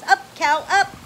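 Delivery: loud urging call; loud cry. Acted. Grand Dude urging the flying cow upward, loud. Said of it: "Grand Dude cried".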